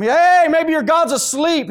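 loud, high, drawn-out taunting call; mocking. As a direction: jeer at them as if calling to someone far off. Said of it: "He started mocking them".

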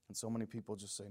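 normal talking voice

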